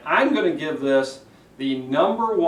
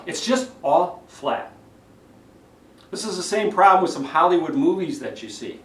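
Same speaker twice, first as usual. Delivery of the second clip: ordinary delivery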